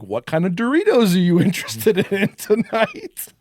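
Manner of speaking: high, put-on salesman voice with a laugh; chirpy demon clerk asking what you'd like; quirky store clerk. Demon clerk greets a customer in a high put-on voice.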